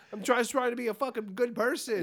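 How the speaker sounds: high, loud exasperated outburst; mock-frantic cry of exasperation at the old lady; exasperated shout. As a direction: yell the apology in frustration. Retold exasperated reply to old lady.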